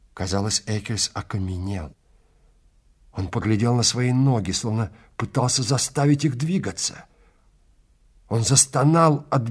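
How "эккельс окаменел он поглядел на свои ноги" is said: usual speaking voice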